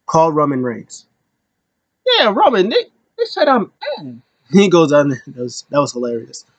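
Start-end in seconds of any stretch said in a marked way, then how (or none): 2.1-4.1 high whiny tattling. Retold or imitated. Paul calling Roman to complain about Finn, high whiny tattling.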